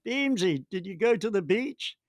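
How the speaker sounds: a hint of a chummy mate ribbing him; Simon Hobday's teasing. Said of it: "he said".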